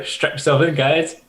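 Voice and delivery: high, eager call to the crew; excited call. Acted. Pilot calls out to crew before launch, eager. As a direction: hype the crew up before takeoff.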